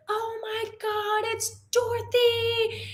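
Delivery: put-on high, slow squeal of a thrilled girl; imitating an awestruck young fan; excited girl. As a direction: gush like a kid spotting her hero. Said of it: "she's like".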